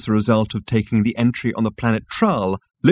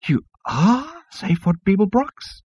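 high, slow squeak; 12 semitones higher, slower than usual. Acted. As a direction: squeak it in awe. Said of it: "it squeaked".

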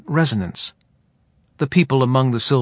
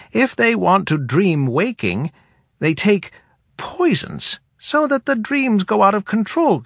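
put-on higher, dry old woman; wry, higher elder woman voice; head woman voice; 9 semitones higher than usual. A narrator's reading.

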